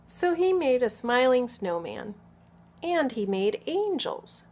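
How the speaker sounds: put-on expressive picture-book narration for small children; story read-aloud. A narrator's reading. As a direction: read clearly and with lively emphasis to children.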